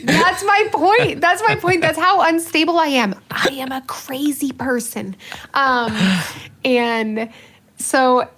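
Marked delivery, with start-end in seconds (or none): 0.0-3.1 giggling admission; laughing. Real, not acted. Admitting to Father Michael while still laughing. Laughing confession of own instability. 3.3-5.2 whispered, slow mock confession. Real, not acted. Confessing to Father Michael in a mock whisper.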